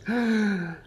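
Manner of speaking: high, sharp caught-out gasp; a quick high intake of breath; guilty gasp. Retold or imitated. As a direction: gasp like a child caught red-handed. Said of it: "he's just like".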